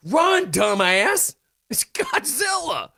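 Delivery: high, drawn-out comic warning; mock panicked yell. Host yells at movie character to flee Godzilla, mock panic.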